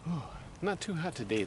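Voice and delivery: normal talking voice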